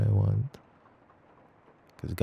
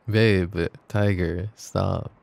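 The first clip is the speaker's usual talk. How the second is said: drawn out; 5 dB louder, much slower than usual. Real, not acted. Stopping partner, drawn out.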